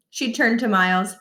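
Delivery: plain reading voice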